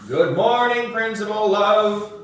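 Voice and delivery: high, loud sing-song greeting; children greeting the principal in unison; class chorus. Acted. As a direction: chant it like a whole class. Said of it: "we all said at the same time".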